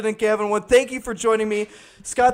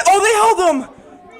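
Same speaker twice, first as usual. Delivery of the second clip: surprised cry; 6 semitones higher, 3 dB louder than usual. Real, not acted. Runner held at third.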